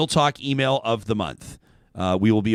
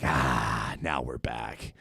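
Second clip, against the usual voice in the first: low, soft exhale of relief; acted-out sigh of relief; relieved sigh; 12 semitones lower, 9 dB quieter, much slower than usual. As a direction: let it drop low and quiet, as if finally unwinding. Relief as travel day ends.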